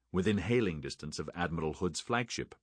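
plain reading voice

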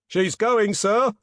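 high, loud warning cry; alarmed yell. A narrator's reading. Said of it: "yelled a voice from the forecastle".

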